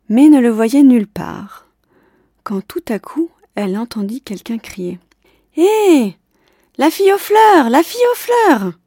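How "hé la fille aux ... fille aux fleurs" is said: high, loud call across a distance; excited loud calling out; shouting from afar. Acted. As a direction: yell down from a high window. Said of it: "criait la voix".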